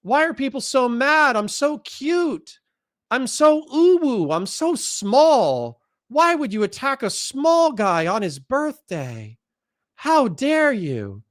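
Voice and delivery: high, drawn-out uwu whine; mocking cutesy voice. Mimicking opponents who play the victim, mock cutesy voice. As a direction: play the helpless innocent, slow and high-pitched, mockingly. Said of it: "just be like".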